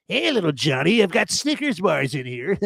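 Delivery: a hint of a creepy van man luring a kid; creepy stranger. Van creep calling to little Johnny, mock creepy coax. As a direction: oily sweet-talk to a child. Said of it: "looking for the children's".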